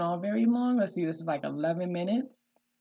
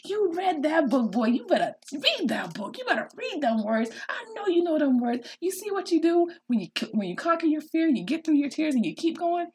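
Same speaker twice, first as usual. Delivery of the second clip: high-pitched proud parent cheer; cheering him on; 10 semitones higher than usual. Retold or imitated. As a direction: gush encouragement at a child who did it. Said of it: "I'm cheering him on like".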